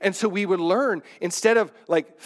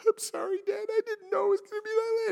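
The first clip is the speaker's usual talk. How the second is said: high tearful apology, much higher; crying daughter; 4 dB quieter, faster than usual. Retold or imitated. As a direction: imitate a teen daughter crying as she apologises. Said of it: "And she's crying".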